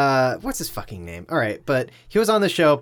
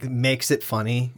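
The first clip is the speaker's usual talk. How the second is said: ordinary talk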